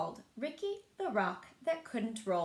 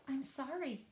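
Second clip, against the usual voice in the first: sorry reply; 4 semitones higher, 6 dB quieter, slower than usual. Acted. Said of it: "but replied".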